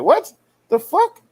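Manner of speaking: high, rising "what the" reaction; retold shocked disbelief. Retelling his reaction to old man's slur, shocked disbelief. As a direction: replay the shock, voice shooting up.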